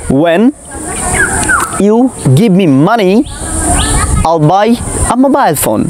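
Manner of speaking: when you give me money said slowly, word by word; slow model sentence. Shown as a demonstration. English teacher dictating a model sentence slowly to viewers. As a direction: spell out the example slowly for learners. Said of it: "তাহলে কী হবে".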